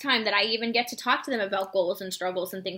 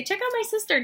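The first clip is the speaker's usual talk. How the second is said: excited pitch; 11 semitones higher than usual. Shown as a demonstration. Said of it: "I always say".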